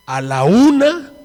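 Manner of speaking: loud, high, drawn-out count; shouted count. Retold or imitated. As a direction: call it out slowly like a countdown. Quoting a man counting out loud. (conteo gritado, lento y agudo)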